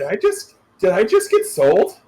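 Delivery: disbelieving squeak of surprise; stunned disbelief. Acted. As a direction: pitch jumps way up on the first words, then settles. Henry stunned, player voices him.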